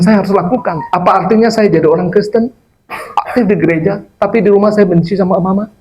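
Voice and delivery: high-pitched, earnest quoted declaration; impassioned testimony. Retold or imitated. Preacher acts out convert's resolve.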